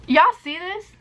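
high, eager question to viewers; excited. Real, not acted. Showing yellowing phone to camera.

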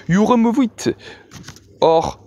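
carefully articulated English, lightly put-on Russian accent; a hint of Russian accent, pausing deliberately between phrases; dreadful Russian accent. A narrator's reading. Huge Russian threatens the unionist, in a carefully articulated Russian accent. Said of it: "articula soigneusement, de son accent épouvantable".

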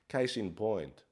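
ordinary talk, nothing marked

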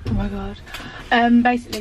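normal talking voice

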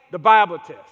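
shouted, low and heavy; loud punch. Real, not acted. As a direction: bang out the Bible test. Preacher punches the phrase.

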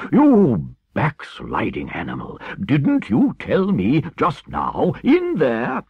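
loud, slow indignant rebuke; outraged Badger scolding; scandalized. A narrator's reading. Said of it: "greatly scandalized".

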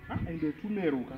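ordinary talk

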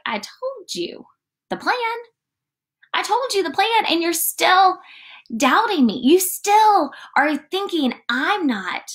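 mock exasperation, pitch swooping high on key words; drawn-out, high-pitched incredulous chiding; exasperated reproach. Jesus to disciples who still doubt him, exasperated.

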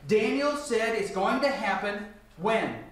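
ordinary delivery

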